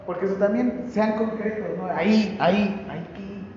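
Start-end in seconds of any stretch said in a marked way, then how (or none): 1.9-3.4 frustrated dad. Retold or imitated. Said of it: "saqué mi frustración como papá".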